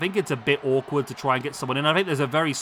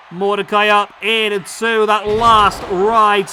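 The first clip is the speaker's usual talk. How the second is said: excited call; 4 semitones higher, 7 dB louder, much slower than usual. Real, not acted. Play-by-play of finishing move.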